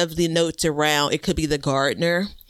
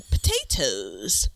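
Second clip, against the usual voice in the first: drawn-out reveal; 6 semitones lower, 3 dB quieter, much slower than usual. Pretend. Hammed-up reveal of next pick.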